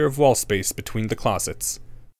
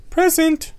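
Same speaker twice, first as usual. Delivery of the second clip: put-on timid girl; 4 dB louder than usual. A narrator's reading. Nervous girl answers roll.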